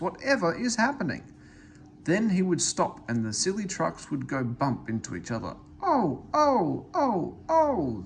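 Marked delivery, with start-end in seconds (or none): 0.0-1.2 high, alarmed cry from the trucks; panicked little trucks asking what's wrong; startled trucks. A narrator's reading. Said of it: "screamed the trucks". 5.8-8.0 high, loud wailing trucks; crying trucks. A narrator's reading. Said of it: "they cried again".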